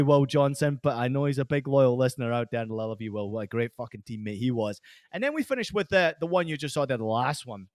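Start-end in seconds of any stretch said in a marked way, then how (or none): none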